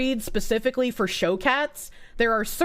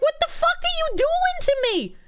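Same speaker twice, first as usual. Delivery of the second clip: voicing the cat. Voicing an offended cat being held, high indignant squeak. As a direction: speak as the offended cat, much higher. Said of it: "This cat is like".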